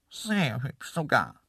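slow, drawn-out creature voice; second antelope voice. A narrator's reading. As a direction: agree smugly, stretching the words. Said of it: "sagte eine andere Stimme". (gedehnte zweite Antilopenstimme)

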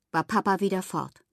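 plain reading voice

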